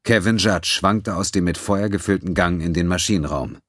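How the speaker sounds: plain reading voice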